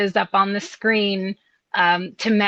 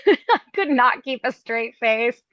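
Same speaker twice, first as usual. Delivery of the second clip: normal talking voice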